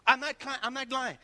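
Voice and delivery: normal talking voice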